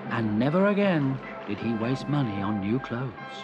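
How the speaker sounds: usual speaking voice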